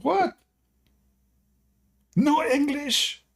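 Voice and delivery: high, slow mock outrage; imitating a stunned drill sergeant; shocked sergeant. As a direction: squeal it in disbelief. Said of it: "he starts screaming".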